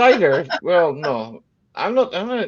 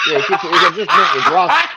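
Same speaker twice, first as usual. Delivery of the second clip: high, louder, laughing while speaking; 8 semitones higher, 6 dB louder than usual. Real, not acted. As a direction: get the words out through laughter. Laughing through the joke.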